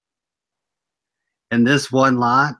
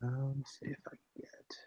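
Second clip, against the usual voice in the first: whispered aside; 22 dB quieter than usual. Real, not acted. Muttering while finding listing.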